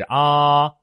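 啊 held long and high without change; level ending. Shown as a demonstration. Teacher showing the end stays level, 啊 long and high. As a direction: sustain 啊 on one high note. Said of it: "结束也是啊".

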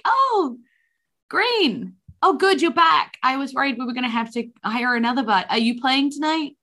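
excited tavern owner. Acted. Tavern owner relieved bard returned.

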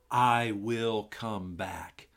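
I will come back said slowly, each word weighed; slow emphasis. Shown as a demonstration. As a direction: lay the promise down word by word. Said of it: "look hard at verse three".

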